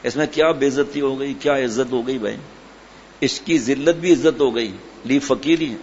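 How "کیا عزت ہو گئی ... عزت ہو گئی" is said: usual speaking voice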